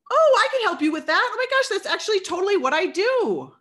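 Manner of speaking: high, loud and gushing; squealing delighted salesperson; gushing excitement. Shown as a demonstration. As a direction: gush like a thrilled seller who found a buyer. Acting an excited seller.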